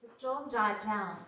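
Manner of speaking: ordinary talk, nothing marked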